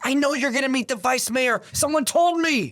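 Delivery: high put-on voice of the accusing woman; imitating the caller. Retelling the caller's suspicious accusation, high imitated voice. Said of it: "She goes".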